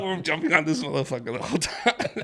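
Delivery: ordinary delivery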